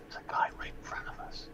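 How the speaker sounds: soft unvoiced whisper. Real, not acted. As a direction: whisper so the enemy can't hear. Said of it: "when I'm talking like that".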